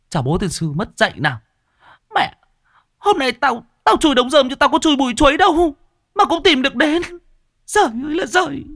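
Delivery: high, fast, furious shrieking abuse; cursing woman. Acted. Caught woman yelling at the crash behind the haystack, high shrieking curses. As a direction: screech it at the intruders from behind the haystack. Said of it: "thò đầu ra mà chửi".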